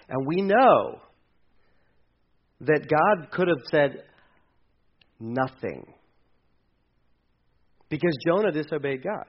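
normal talking voice

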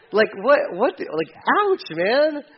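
playfully imagine the scorpion whipping; mock ouch. Preacher picturing scorpion beatings, mock wincing. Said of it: "I'd love to see how that happened".

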